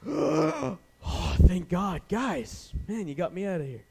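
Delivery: big gasp, then high relieved outburst; hammed-up breathless relief. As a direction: burst free and thank your rescuers. Acted Lazarus gasping as unbound.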